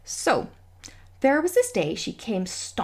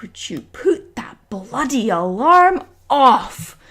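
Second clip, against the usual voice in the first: loud, slow, exasperated demand; retold angry demand; 6 dB louder, much slower than usual. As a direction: spell it out loudly, word by word. Retelling storming in over alarm.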